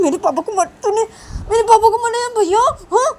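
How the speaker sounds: put-on high, loud little boy; upset child. Son accuses cousin, high and loud.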